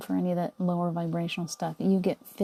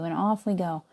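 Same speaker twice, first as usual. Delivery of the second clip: normal talking voice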